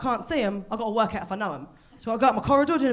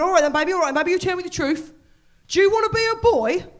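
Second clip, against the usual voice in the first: raised, louder voice of a flustered dad; confused dad impression; 7 semitones higher, 8 dB louder than usual. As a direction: build up to the big question, high and loud. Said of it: "rang me up very confused".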